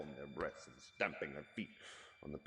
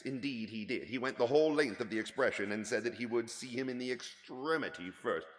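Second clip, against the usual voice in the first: usual speaking voice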